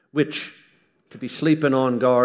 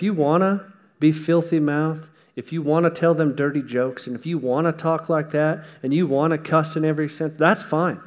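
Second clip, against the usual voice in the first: raised, louder preaching voice building to a point; preaching with force; 5 semitones higher, 4 dB louder than usual. Acted. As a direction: drive the warning home to the congregation. Preacher pressing his point.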